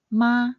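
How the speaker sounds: MA high and level; high, even MA, held without falling; first tone. Shown as a demonstration. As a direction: say MA loud on a steady high pitch. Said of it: "the tone mark for the first tone".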